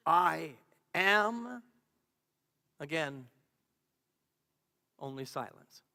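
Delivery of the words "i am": louder, ringing out after the hush; strong declaration. Acted. Voice in the hush making itself heard, loud declaration. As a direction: declare it firmly and loudly.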